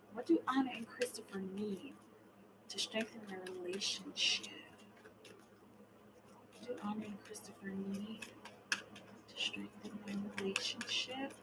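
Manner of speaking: murmured card question. Real, not acted. Tarot reader repeating a client's question to the cards, murmured.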